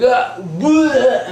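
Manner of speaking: mock the driver throwing up; imitated puking. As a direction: gasp into a loud high retch. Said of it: "like, puking their guts out".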